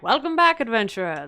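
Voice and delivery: game character's welcome line; statue's greeting. Retold or imitated. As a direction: hail the returning hero. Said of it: "And he's like".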